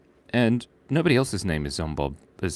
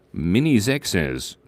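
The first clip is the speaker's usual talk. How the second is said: ordinary talk, nothing marked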